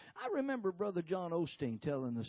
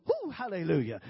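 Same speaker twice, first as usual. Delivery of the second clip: loud mock whoop, then hallelujah; 8 dB louder than usual. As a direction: whoop at them as they walk by. Woman whooping at passing coworkers.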